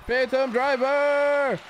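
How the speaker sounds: drawn-out, high-pitched call; calling out the move. Real, not acted. Player calling his Phantom Driver in a wrestling game, drawn-out excited call. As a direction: stretch it out like a triumphant move call.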